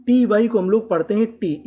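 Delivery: टी वाई read as टी, a short tee, not टाई. Shown as a demonstration. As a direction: say टी for ty, never टाई. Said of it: "इसे हम लोग टाई नहीं पढ़ते".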